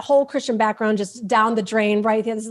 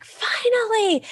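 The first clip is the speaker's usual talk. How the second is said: soul's relief; 8 semitones higher, slower than usual. Retold or imitated. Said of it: "my soul was like".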